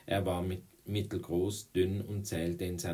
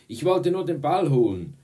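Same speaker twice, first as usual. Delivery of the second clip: loud, high self-defence; protesting shout; 9 semitones higher, 12 dB louder than usual. A narrator's reading. Boy excuses himself to the girls, protesting shout. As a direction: yell your excuse over the attackers.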